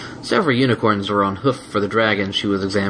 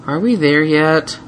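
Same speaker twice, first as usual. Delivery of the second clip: plaintive; 7 semitones higher, 4 dB louder, slower than usual. A narrator's reading. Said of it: "a plaintive voice".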